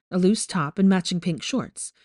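ordinary delivery

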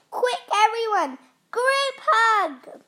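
rally everyone in a hurry; urgent rallying call. A narrator's reading. Hot chilies rallying to thaw hero, urgent call.

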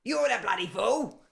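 high, loud laughing shout; a squabbling dwarf yelling back, half laughing. Acted. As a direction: bellow it at your brother. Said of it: "just shouts".

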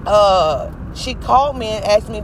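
normal talking voice

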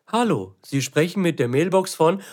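slow, stiff computer voice announcement. Retold or imitated. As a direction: imitate an old speech synthesizer. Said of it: "dass also einmal die Computerstimme sagt". (langsame Computerstimme)

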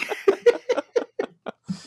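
squealing high-pitched laugh. Real, not acted. Laughs after dad impression.

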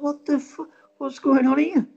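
high, building disbelief; incredulous. Retold or imitated. Watcher sees the catch repeated, incredulous.

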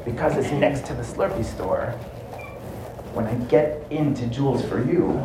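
hushed, secretive plotting; soft self-talk from a hiding place; talking to himself. Acted. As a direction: think aloud softly so nobody hears. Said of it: "he's just talking to himself".

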